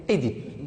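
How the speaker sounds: Édipe slowly, with a clear "é"; older pronunciation. Shown as a demonstration. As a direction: say Édipe as "é-dipe", measured. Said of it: "on l'a prononcé avant".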